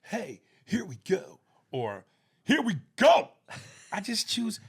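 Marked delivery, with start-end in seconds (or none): 0.0-1.4 laid-back delivery. Retold or imitated. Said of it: "if I had to choose between". 2.4-3.3 here we go shouted high and loud; big theatrical delivery. Retold or imitated. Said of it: "if I had to choose between".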